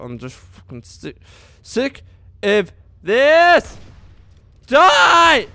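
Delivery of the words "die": high, loud command; battle yell. Real, not acted. Gamer finishing off an enemy, battle yell.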